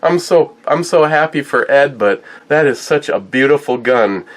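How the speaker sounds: mimicking wife's delighted disbelief; excited quote. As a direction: play wife's thrilled outburst. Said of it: "And Christine's going".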